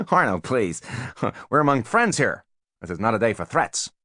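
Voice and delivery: a hint of a brash American; brash American businessman. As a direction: gush with slick flattery. Voices Spiro.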